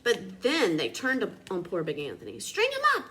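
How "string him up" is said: high, loud furious cry; angry mob shout. A narrator's reading. Town men turning on Big Anthony, furious shout. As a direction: demand it like a mob. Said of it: "the men of the town shouted".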